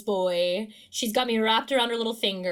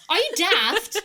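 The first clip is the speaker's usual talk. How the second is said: high, loud hammed-up British voice; cheeky Brit islander impression; mock British accent; 8 semitones higher, 4 dB louder than usual. Host quoting favourite British slang, in a hammed-up British accent. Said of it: "my other favorite".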